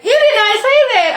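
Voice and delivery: shocked outburst. Retold or imitated. Mother hearing a crude boast, shocked outburst. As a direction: blurt it out scandalised. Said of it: "She was like".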